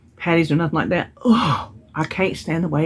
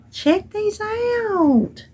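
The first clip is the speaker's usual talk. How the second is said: much higher, very slow drawn out delight; excited unboxing; much slower than usual. Real, not acted. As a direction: stretch it out in wonder. Unboxing the gift box.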